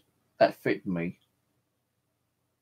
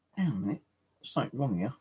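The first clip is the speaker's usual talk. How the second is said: softer, as a private thought; thinking aloud; 8 dB quieter, much faster than usual. Retold or imitated. Shopper realising something is wrong at the exit, quiet thinking aloud. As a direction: say it quietly to yourself.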